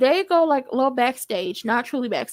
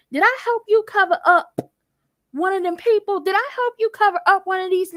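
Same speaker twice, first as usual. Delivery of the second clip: high, loud demanding questions; confronting; 6 semitones higher, 3 dB louder than usual. Retold or imitated. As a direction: press him hard for answers. Voicing Uncle Clifford confronting him.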